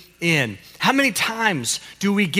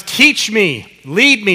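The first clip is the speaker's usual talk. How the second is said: firm, raised call to copy; louder, lifted cue for calling to God; 4 semitones higher, 6 dB louder, slower than usual. Shown as a demonstration. Pastor coaching a child actor to cry out to God, calling out. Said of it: "to really call out to God".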